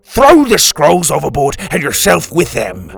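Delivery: furious captain yelling; angry yell. A narrator's reading. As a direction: bellow an order at a crewman. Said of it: "Drake yelled".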